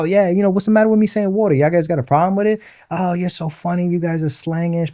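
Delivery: mock defensive teasing. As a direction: fire back at the teasing, half joking. Retold teasing argument over accents.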